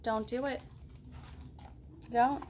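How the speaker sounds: plain reading voice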